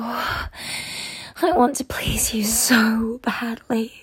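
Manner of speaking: drawn-out pleading whisper; slow, longing whisper. Acted. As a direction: stretch it out in a whisper.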